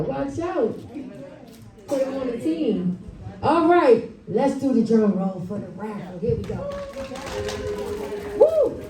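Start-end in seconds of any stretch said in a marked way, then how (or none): none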